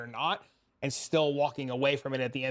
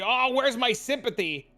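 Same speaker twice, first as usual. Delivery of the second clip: higher, louder sarcastic whine; mocking whiny plea; 7 semitones higher, 4 dB louder than usual. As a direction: ham up the viewer's pity plea. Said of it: "And then he said".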